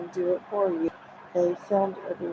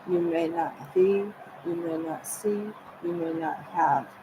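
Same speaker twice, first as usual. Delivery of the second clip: usual speaking voice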